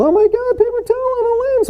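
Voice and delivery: high-pitched mock panic; freaking out viewer. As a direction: squeal it like a horrified viewer. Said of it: "I know everyone's freaking out".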